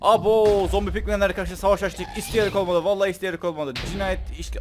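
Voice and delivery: high, loud frantic yelling; panicked yelling. Real, not acted. Having angered the pigmen, yells in panic. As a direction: shout in full alarm.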